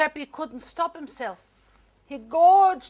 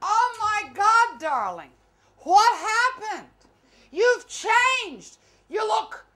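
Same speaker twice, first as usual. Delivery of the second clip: higher, slow gushing exclaim; over-the-top shocked greeting; gushing friend; 7 semitones higher, slower than usual. Acted. Old friend shocked at how Debbie changed, higher gushing.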